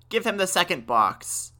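put-on old man's mild cry of desperation; urgent cry. A narrator's reading. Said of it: "cried the old man".

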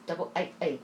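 "A A" pushed towards "eye eight"; sharp British A. Retold or imitated. Recalling confusing London speech, "A A" sharpened almost to "eye eight". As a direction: say "A A" with sharp, widened British vowels. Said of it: "such a sharp A".

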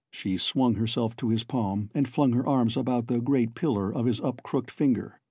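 ordinary delivery

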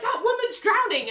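high-pitched imitation of an appalled protest; mock outrage. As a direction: wail it in hammed-up indignation. Retelling Ali's outrage at the joke.

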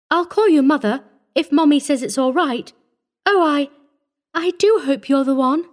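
put-on high-pitched, earnest little girl; obedient child. A narrator's reading. Grave child hoping she is the one, obedient. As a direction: speak high and hopeful, as a child. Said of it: "the grave child nodded obediently".

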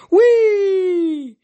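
high, long gliding "wheee"; gleeful high whee, falling slowly; whizzy whee. A narrator's reading. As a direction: sail it out like an arrow whizzing past. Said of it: "They made whizzy sounds as they flew".

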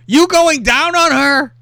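high, loud, drawn-out mock outrage; mock angry demand. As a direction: read the jealous boyfriend's text as an outraged challenge. Reads jealous boyfriend's angry text.

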